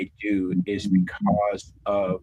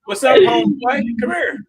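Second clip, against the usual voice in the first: daughter's voice; 8 dB louder than usual. Retold or imitated. Daughter's greeting voiced high.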